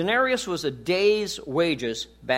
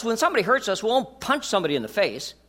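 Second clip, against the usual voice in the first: normal talking voice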